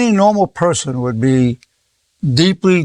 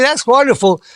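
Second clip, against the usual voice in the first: raised, quick, gushing fake cheer; mock optimism; 6 semitones higher than usual. Telling a bereaved man it's great, mock high cheer. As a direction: pretend to be thrilled by tragedy. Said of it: "if I just would've said".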